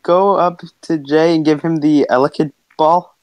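usual speaking voice

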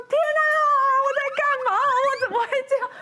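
retold panic. Retells crying out in tunnel.

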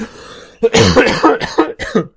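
loud high choking cough. Real, not acted. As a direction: cough hard after swallowing wrong. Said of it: "Ich hab mich verschluckt beim Vorlesen".

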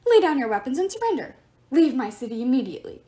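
loud officious order; commanding. Acted. Statue god ordering.

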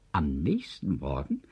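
ordinary delivery, nothing marked

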